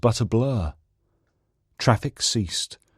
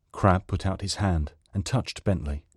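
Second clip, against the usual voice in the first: plain reading voice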